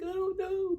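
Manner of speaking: high tearful put-on wail, laughing through it; fake crying. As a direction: cry it out as an act. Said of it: "But most of it's an act".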